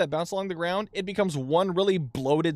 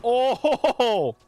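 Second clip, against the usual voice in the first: surprised; 7 semitones higher, 6 dB louder than usual. Real, not acted. Sudden game event.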